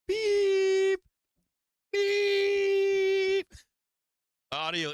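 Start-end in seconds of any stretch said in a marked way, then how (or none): 0.1-3.5 loud, high-pitched beeeep imitation; mock censor beep. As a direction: shout out the censor beep like a machine. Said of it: "So it's like".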